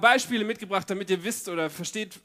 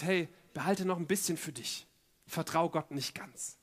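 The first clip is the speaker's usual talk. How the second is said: lightly acted soft, lowered coaxing; mild sly tempter; 4 semitones lower, 7 dB quieter than usual. Inner voice tempting Hananias and Sapphira, quietly coaxing. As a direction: keep it quiet, like a secret suggestion.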